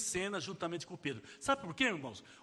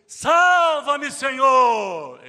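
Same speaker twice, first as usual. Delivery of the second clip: high, loud cry for help; desperate shout; 7 semitones higher, 16 dB louder, much slower than usual. Acted. Preacher voicing the sinking Peter, shouting for help. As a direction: yell it as if you're going under.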